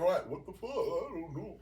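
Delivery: lightly mocking, befuddled voice; Elon Musk impression. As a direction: act out someone clueless, lightly mocking. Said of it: "This is how he sound".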